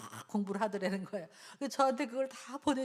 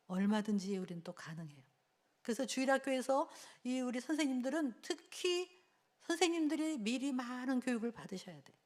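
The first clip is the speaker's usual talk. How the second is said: normal talking voice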